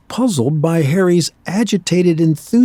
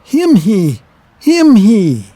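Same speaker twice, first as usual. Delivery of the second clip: loud, urgent wordless grunting; a mute giant pushing his point; eager grunts, louder; insistent grunt; 5 semitones higher, 6 dB louder, slower than usual. Acted.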